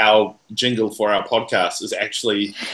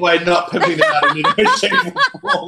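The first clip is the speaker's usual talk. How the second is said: laughing; 13 semitones higher than usual. Real, not acted. Laughing at own plug.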